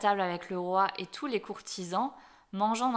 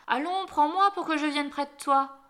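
high, loud demand; crying out; 6 semitones higher, 5 dB louder than usual. A narrator's reading. Frog at the chair demanding to be picked up, crying out. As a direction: call up insistently to be lifted. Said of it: "elle s'arrêta et cria".

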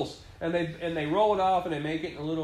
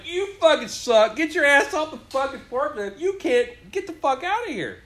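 mock angry boss; 8 semitones higher, 4 dB louder than usual. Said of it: "And they went".

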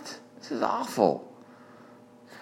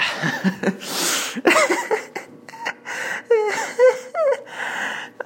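long high wheezing laugh fit, half crying; helpless laughter; 4 dB louder than usual. Real, not acted. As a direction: lose it completely, laughing to tears.

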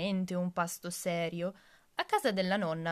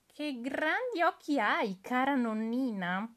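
put-on little girl; 7 semitones higher, 4 dB louder than usual. Girl questioning disguised wolf.

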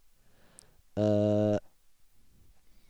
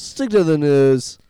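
loud slow demand; 4 semitones higher, 9 dB louder, slower than usual. Real, not acted. Mock demand to BBC.

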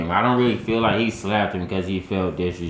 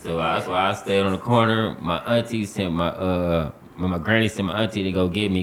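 normal talking voice

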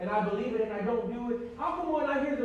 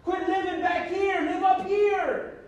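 preaching at full force; 8 semitones higher, 8 dB louder than usual. Acted. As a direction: drive it home to the church. Preaching climax to congregation.

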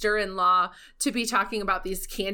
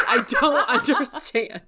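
laughing; 5 semitones higher, 5 dB louder than usual. Real, not acted. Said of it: "laughing mid-line".

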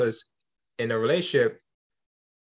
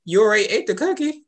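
high, louder mock parent scolding a child; 9 semitones higher, 4 dB louder than usual. Parent scolding the kid who already ate the cookie, mock stern. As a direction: play the parent catching the kid mid-bite.

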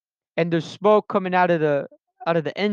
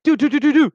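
high, loud singsong doo-doo-doo; bouncy, high-pitched nonsense syllables; imitated carefree driver; 8 semitones higher, 5 dB louder, faster than usual. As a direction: mimic a driver tootling along.

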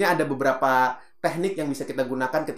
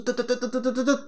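quick higher tut-tut-tut imitating fast talk; mock fast-talker noise; rapid tut sounds; 8 semitones higher than usual. Shown as a demonstration. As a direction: rattle off tut like machine-gun speech. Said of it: "Kalau ngomong tuh".

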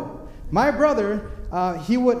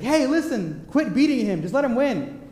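parents yelling; 8 semitones higher, 4 dB louder than usual. Retold or imitated. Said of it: "they would yell at my brother".

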